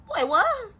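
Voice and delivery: hammed-up incredulous squeal on "what"; exaggerated disbelief. Repeated in disbelief.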